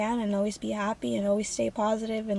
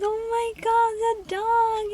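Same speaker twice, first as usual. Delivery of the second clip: much higher, slow, squealing with delight; thrilled squeal; 13 semitones higher, slower than usual. Retold or imitated. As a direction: gasp it out as the surprise hits. Finding the surprise dog.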